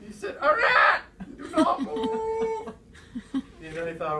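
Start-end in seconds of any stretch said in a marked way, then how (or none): 0.4-2.7 very high shout, slowing right down; shout, then stretch the order out high; urgent warning. Retold or imitated. Friend orders Jean to freeze on the ice, high drawn-out warning.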